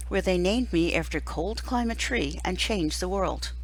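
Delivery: ordinary delivery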